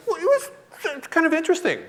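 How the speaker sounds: very high, hesitant hedging; evasive answer. Retold or imitated. Husband dodges his wife's question, high and hesitant. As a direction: squeak it out reluctantly with a pause.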